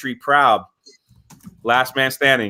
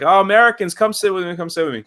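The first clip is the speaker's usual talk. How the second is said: broken English; 6 semitones higher, faster than usual. Retold or imitated. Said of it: "trying to talk broken English".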